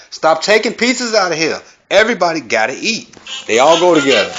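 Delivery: high, stern granny scolding; a grandma shooing hands from the pot. Pretend. Grandma scolding relatives picking at food, high stern voice. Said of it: "This is from Granny".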